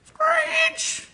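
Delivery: a very high screech; screechy. Shown as a demonstration. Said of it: "Use onomatopoeia".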